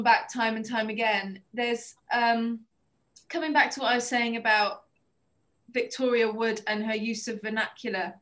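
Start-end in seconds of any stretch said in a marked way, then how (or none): none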